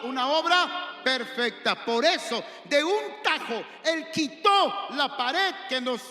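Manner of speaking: loud, high, slow preacher's climax; fiery preaching. Acted. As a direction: build the sermon to a shout. Preaching at full force. (predicación encendida a gritos)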